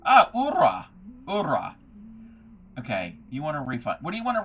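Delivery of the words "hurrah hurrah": "ura" with no H and a rolled R. Shown as a demonstration. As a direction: roll the R in "ura", H left silent. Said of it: "Oh, roll the R?".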